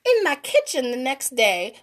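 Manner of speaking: ordinary delivery, nothing marked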